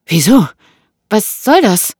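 high, loud questions; alarmed woman. Acted. Woman asks what his talk means, alarmed. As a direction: challenge him in alarm.

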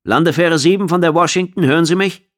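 high, loud hail ending in a question; radio caller. A narrator's reading. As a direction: call out over the radio. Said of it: "tönte eine Stimme aus dem Funkgerät".

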